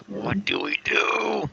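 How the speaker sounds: strained and high, through clenched teeth; through gritted teeth. Acted. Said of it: "I kinda say through gritted teeth".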